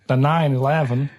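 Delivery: a light American accent. Shown as a demonstration. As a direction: put on an American accent for the phrase. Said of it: "Do that American accent again?".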